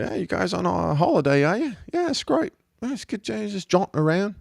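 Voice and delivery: high, chirpy mock Kiwi accent; New Zealand accent. Driver greeting young hitchhikers, in a high New Zealand accent. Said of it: "caught a ride with this guy".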